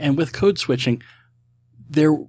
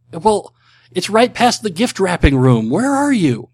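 loud, high, drawn-out comic retort; hammed-up disbelief, swooping high on the question; mock exasperation; 8 semitones higher, 7 dB louder than usual. Answering someone lost in Niles's apartment, mock exasperated. Acting out a Frasier joke.

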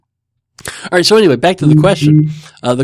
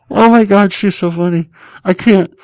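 high and loud through laughter; laughing; 5 semitones higher, 4 dB louder than usual. Real, not acted. Host telling listeners his wife is funny, laughing. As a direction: gush while still laughing.

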